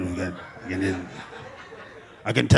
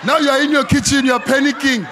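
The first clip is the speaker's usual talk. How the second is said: laughing panic; 9 semitones higher, 4 dB louder than usual. Acted. Panicking in the kitchen.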